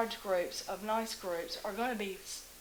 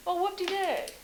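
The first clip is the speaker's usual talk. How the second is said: sarcastic; 9 semitones higher, 3 dB louder than usual. Real, not acted. Sarcastic remark on Airbnb purchase.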